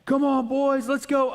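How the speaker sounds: high, loud cheering yell, slowing at the end; imitating a dad bellowing from the bleachers; dad yelling from the stands. Dad cheers his son's team from the stands, mock yelling high and loud. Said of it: "He's going".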